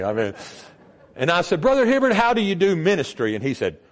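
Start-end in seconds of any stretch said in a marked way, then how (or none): 1.6-3.3 put-on eager young man. Young man asking the pastor.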